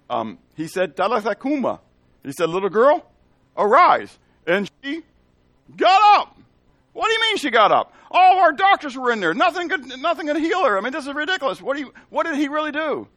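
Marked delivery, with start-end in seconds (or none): 0.9-4.2 put-on "Talitha cuma" pitched higher, "Little girl, arise" louder and higher; incredulous retelling. Townspeople retell Jesus's words. 4.5-13.1 much higher, louder, incredulous townsperson voice; amazed townsperson. Retold or imitated.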